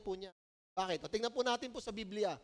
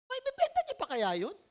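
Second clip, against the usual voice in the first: voices his own puzzled thought well above his usual pitch; high, wondering inner voice; mock inner voice; 11 semitones higher, 3 dB louder than usual. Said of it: "Isip ko".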